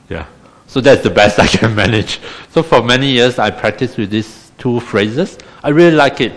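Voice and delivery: ordinary talk, nothing marked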